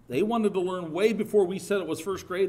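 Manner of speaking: ordinary talk, nothing marked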